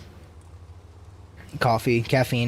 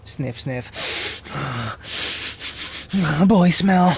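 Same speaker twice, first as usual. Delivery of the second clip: swooning sniff; 2 semitones higher, much slower than usual. Pretend.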